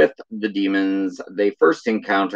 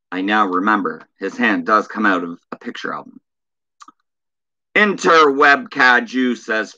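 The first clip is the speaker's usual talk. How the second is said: ordinary talk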